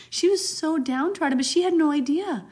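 delighted. Real, not acted. Laughing over the widow's story.

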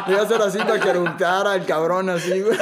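giggling through the punchline; laughing. Real, not acted. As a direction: crack up while telling it. Laughing at own story.

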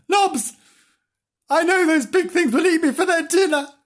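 high, loud scared kitten voice; frightened kitten. Acted. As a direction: wail at the dog. Kitten fears cars.

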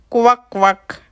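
loud croaky kvak; frog croak. A narrator's reading. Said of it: "hangotázott a béka".

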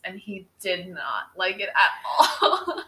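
slow words breaking into laughter; amused laughter. Real, not acted. Telling how Jacob hated the nickname, laughing.

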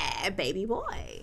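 B Simone impression. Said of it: "you don't like B Simone".

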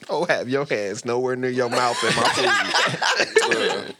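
slow, loud, laughing complaint. Real, not acted. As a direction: talk through big laughter. Mocking chef, laughing.

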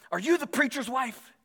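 high-pitched mock confrontation; imitated accusing question; storming neighbor. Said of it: "like, storming over to her".

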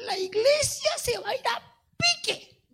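very high put-on woman's voice; mocking woman's voice. Pastor imitating the woman at the church door, very high. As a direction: mimic the woman's shrill warning. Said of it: "es que ella hablaba así".

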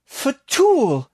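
a high, loud ritual cry; calling out the summoning. Acted. Bailey calling out the summoning over the circle, high and loud. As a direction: call the name out across the circle. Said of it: "Bailey called out the summoning".

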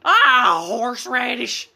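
sudden high, loud yell; scream. Retold or imitated. Joking with a friend on the beach, screaming a fake swear. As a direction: blurt it out as a silly scream. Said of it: "I just scream".

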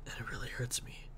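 whispered, near crying; holding back tears. Real, not acted. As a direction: whisper it while fighting tears. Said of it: "I'm trying not to cry".

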